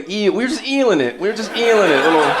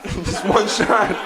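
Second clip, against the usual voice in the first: slow repeat, cracking up; laughing through the line; 3 semitones higher, much slower than usual. Retold or imitated. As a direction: say it again but laugh through it.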